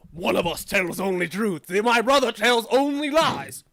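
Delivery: loud, slow recital of the riddle; riddle guardian. Acted. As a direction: intone it ceremonially.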